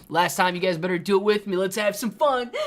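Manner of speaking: rally everyone to join in; hyped rallying call. Real, not acted. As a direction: urge the crowd along, loud and high. Rallying viewers to join the chant.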